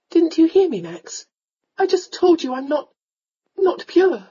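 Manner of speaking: high, emphatic, upset heroine; distressed young lady. Acted. Young lady tells the lord she's ruined, distressed.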